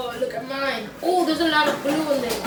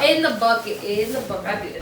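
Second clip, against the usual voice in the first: usual speaking voice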